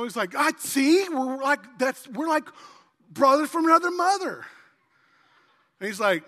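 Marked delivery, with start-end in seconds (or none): 0.3-4.4 loud, high, gushing delight; ecstatic bonding. Acted. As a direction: gush loudly, pitched up. Acted joke, bonding with stranger.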